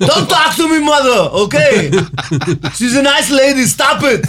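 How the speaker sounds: hammed-up outburst in a thick Austrian accent; Arnold Schwarzenegger. Podcaster imagines Schwarzenegger in Psycho, mock Austrian-accented shouting. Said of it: "να παίζεις στο ψυχό Arnold Schwarzenegger".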